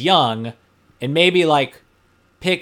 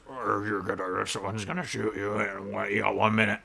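low, soft menacing phone voice; lightly voiced sinister caller; spooky scary voice; 5 semitones lower, 7 dB quieter than usual. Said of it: "Spooky, scary voice that goes".

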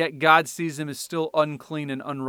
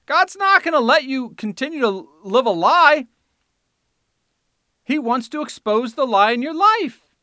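much higher and louder, hammering warning; fiery preaching; 8 semitones higher, 8 dB louder than usual. Real, not acted. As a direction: preach it at full force.

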